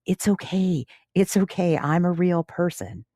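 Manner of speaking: a hint of a small girl being grown-up about it; little girl voice. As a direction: play the kid calmly reassuring her mother. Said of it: "She goes".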